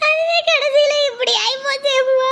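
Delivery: கடைசியில dragged out through tears; crying. Acted. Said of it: "ஐயோ, அழாதடி". (அழுகையுடன் மெதுவாக)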